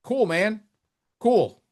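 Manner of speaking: fake-cheer him to show it's pointless; sarcastic praise. Real, not acted. Host mocking announcer's trivia with sarcastic cool.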